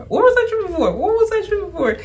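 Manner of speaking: very high-pitched delighted disbelief; excited squeal. Real, not acted. Amazed, repeats question in squeal.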